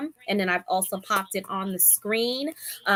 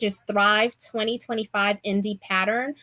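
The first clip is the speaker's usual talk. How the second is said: ordinary talk, nothing marked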